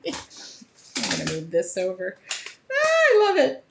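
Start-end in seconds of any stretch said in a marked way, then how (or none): 2.7-3.6 much higher and louder with joy; a high, thrilled cry of love for it; real excitement. Real, not acted. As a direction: burst out with genuine delight. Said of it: "I am really excited".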